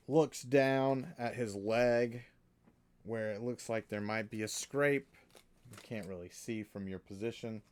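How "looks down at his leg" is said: normal talking voice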